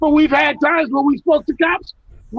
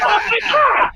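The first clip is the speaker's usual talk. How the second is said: laughing disbelief; 9 semitones higher than usual. Retold or imitated. Amused reaction to cops' praise.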